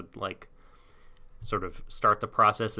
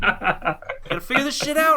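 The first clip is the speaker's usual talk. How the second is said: high, louder, laughing through it; laughing Ash; 6 dB louder than usual. Retold or imitated. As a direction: mutter it while cracking up. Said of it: "Ash is just like".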